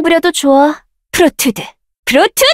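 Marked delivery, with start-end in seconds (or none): 2.1-2.4 pitched up, rising end; sharp higher call. Acted. Caster calling the spell sharply, higher. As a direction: snap it out higher.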